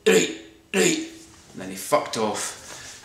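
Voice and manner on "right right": mimicking a guy yelling into his phone, pitch jumping way up; hammed-up caller shouting where are you; mock phone call. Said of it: "he's like".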